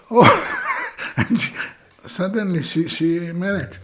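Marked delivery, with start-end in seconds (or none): 0.3-1.1 a high airy giggle that breaks out; high breathy laugh. Real, not acted. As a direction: laugh lightly, almost silent. Laughs at the story.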